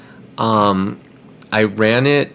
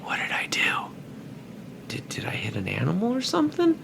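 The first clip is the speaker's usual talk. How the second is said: whisper; 8 semitones higher, 11 dB quieter than usual. Retold or imitated. Startled son answering his dad.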